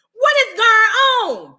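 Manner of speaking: high, loud, drawn-out mock outcry; exasperated disbelief. Teacher reacting to a student's confession, exasperated disbelief. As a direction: cry it out slowly in acted dismay.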